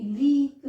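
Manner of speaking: लीक loud, with a long ee; long vowel. Shown as a demonstration. Teacher stressing the sound for learners, loud long लीक. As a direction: push and stretch the ee in लीक. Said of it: "sound आ रही है ई की".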